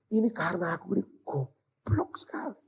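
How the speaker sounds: softly retold self-blame; hushed self-reproach. Preacher blames himself for wasted chances, hushed self-reproach. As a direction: drop the voice, blaming yourself.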